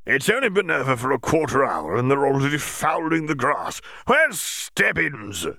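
high, irritable outburst; Bagman annoyed. Acted. Bagman complaining about the dogs fouling the grass, high and irate. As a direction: huff about the mess, rising to a demand. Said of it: "he shouted".

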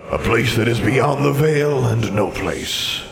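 eerie drawn-out spectral reply; solemn ghost. Acted. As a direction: intone it as an otherworldly old wizard. Ghost describes banishment.

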